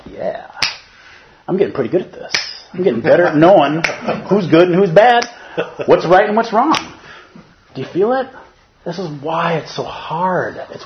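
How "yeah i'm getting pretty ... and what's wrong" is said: smug self-praise. Retold or imitated. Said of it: "I'm kinda going like".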